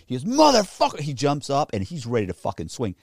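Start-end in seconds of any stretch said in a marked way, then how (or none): 0.2-1.0 loud high furious yell; furious shout. Retold or imitated. Angry man about to swing, shouting. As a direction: explode at him.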